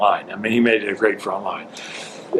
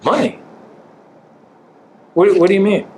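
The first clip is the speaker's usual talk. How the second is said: incredulous; 8 semitones higher, 3 dB louder than usual. Retold or imitated. Owner mock surprised at salary question.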